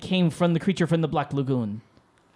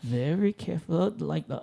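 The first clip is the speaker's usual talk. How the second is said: ordinary delivery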